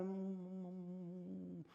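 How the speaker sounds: mock mumbled prayer. Pastor mocking congregation's silent humming prayer. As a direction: hum like someone praying without words. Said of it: "En la iglesia a veces estamos orando".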